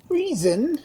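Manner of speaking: loud, high, fierce outburst; fierce cry. A narrator's reading. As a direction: spit the word back at her. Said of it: "cried Edmund fiercely".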